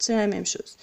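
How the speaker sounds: ordinary talk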